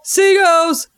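high, drawn-out team chant; gleeful high call-out; fan cheer. Pretend. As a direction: break into a high cheer for your side. Fan cheer inside the results read.

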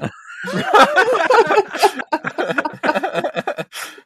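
laughing on and on, high; can't stop laughing at the joke; long real laugh. Real, not acted.

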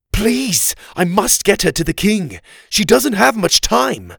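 high, loud, desperate plea; urgent pleading. A narrator's reading. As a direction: plead for her life at the top of the voice. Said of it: "Oliver pleaded".

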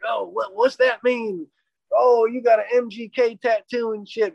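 lightly voiced cop trying to be his buddy; mock cop voice. Imitating the friendly cop.

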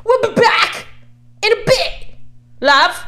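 high, loud, hammed-up British sign-off; exaggerated British reality-show voice; mock British accent. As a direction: belt it out as a cheeky Brit. Mock Love Island sign-off, put-on voice.

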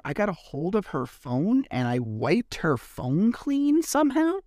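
slow, disbelieving retelling, jumping high on the last word; mock incredulity. Retelling the ex's claim about the wiped phone, incredulous. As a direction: draw out the absurd claim, end on a high questioning rise.

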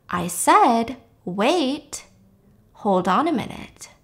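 quiet, measured, deliberate; sober, normal voice. Retold or imitated. Woman suddenly sober stops the man, sober normal voice. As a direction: drop the act and speak soberly, with pauses. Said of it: "in a very sober, normal voice".

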